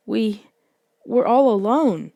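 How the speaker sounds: plain reading voice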